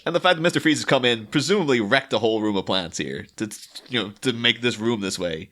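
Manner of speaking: usual speaking voice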